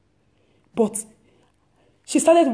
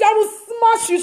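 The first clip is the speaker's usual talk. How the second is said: playful threat; 7 semitones higher than usual. Retold or imitated.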